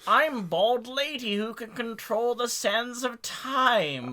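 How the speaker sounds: high, slow witchy crone voice; witch voice. Acted. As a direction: play it like an old witch. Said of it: "you're making her sound like a witch".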